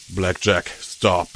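low, calm and steady. Acted. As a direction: say it calmly, in control. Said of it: "said calmly beside me".

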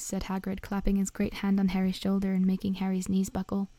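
usual speaking voice

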